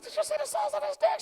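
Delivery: high-pitched, loud amazed question. Retold or imitated. Telling his wife about the son, high amazed voice. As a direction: squeal it in disbelief.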